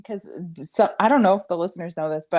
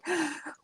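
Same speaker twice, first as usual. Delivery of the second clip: dramatic gasp; 10 semitones higher, 8 dB quieter than usual. Retold or imitated.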